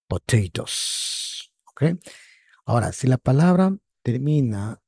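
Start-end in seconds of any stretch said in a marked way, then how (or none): 0.1-1.5 "potatoes" breathed out very slowly; slow model word. Shown as a demonstration. Teacher writing and saying "potatoes" slowly for the class. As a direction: stretch "potatoes" in a near-whisper. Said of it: "ponemos ahí".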